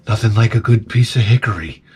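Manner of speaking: low, darkly pleased killer line; menacing killer voice. Pretend. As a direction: savor it like a brutal murderer. Dog as axe murderer quote.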